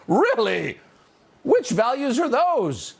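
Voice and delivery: high, slow incredulous sarcasm with a laugh; sarcastic scoff. Real, not acted. Host laughing off a political ad, high slow sarcasm.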